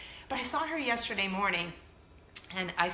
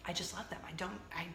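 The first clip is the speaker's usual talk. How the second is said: whisper; 2 semitones lower, 10 dB quieter than usual. Real, not acted. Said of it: "confessing love of old people".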